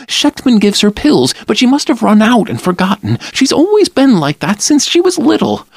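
high, hurried anxious mother; speaking rapidly. A narrator's reading. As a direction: rush the words out in worry. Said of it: "she began to speak very rapidly".

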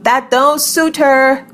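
high, slow, drawn-out mild yell; restrained yell, pitched high and stretched. A narrator's reading. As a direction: call it back in protest. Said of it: "I yelled".

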